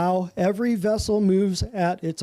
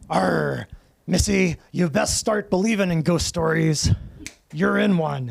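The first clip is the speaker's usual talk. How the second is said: lightly voiced pirate growl; a hint of a pirate captain; slower than usual. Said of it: "And the pirate says".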